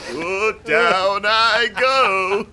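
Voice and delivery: put-on slow, high, loud dying old man; drawn-out comic old man's fall; Hans Moleman. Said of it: "Hans Moleman".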